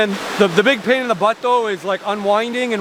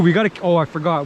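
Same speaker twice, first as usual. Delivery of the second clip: ordinary talk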